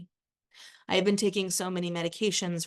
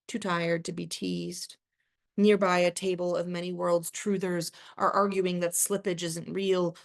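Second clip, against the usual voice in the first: ordinary delivery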